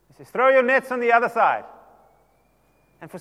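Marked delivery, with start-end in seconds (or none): 0.3-1.6 loud, higher call across the water. Acted. Jesus calls across to the boat, loud and high. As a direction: project it out to the boat. Jesus calling instruction from shore.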